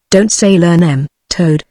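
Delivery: ordinary delivery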